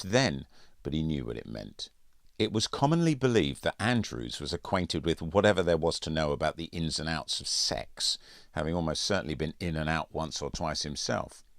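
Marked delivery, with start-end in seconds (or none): none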